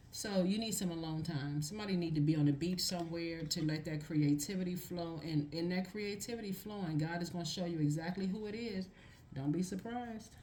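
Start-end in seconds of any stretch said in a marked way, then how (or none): none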